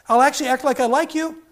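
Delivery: a cat bargaining; lightly mock cat. Cat voice continues.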